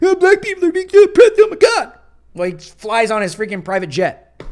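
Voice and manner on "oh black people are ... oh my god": very high, loud, fast whiny mock lament; complaining on Twitter after a game, mocking; mocking LeBron James. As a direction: ham up a rich star's fake complaint. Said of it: "he'll go on Twitter after the game and be like".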